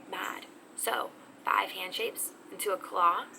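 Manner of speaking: ordinary talk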